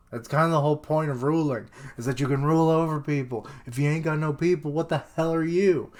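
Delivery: amused rant. Real, not acted. Reader ranting at the villain's logic, fast and incredulous. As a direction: rant it in disbelief.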